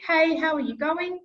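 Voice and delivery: cheerful recorded hello; banked greeting. Shown as a demonstration. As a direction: greet them brightly. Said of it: "So it's going to be".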